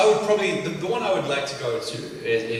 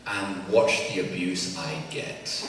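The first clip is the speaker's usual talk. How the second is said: normal talking voice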